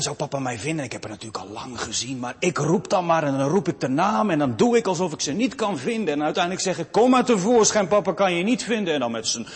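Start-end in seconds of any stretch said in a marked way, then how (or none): 2.2-6.9 raised, higher story-preaching voice; animated preaching. Acted. Preacher acting out the father's game for the church, animated and loud. 6.9-8.9 high, loud playful calling out. Retold or imitated. Father calling his hiding daughter, loud playful call.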